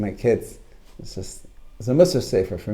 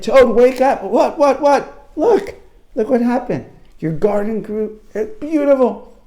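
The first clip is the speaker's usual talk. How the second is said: high, loud storybook frog; high-pitched amazed exclaiming; excited wake-up call; 11 semitones higher, 6 dB louder, slower than usual. Acted. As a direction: rouse a sleeper with thrilling news. Frog waking Toad excitedly.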